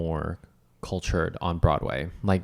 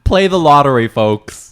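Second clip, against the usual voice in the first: loud, higher proclamation; mock announcer; 5 semitones higher, 10 dB louder than usual. Co-host plugging the lottery to listeners, mock announcer. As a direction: announce it loudly to the audience.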